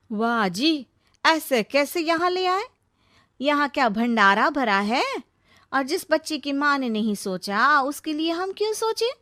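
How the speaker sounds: mock and refuse, sharply; sarcastic objection.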